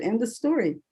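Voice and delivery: ordinary talk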